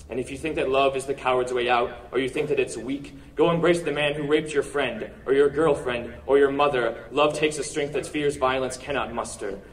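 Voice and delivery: ordinary talk, nothing marked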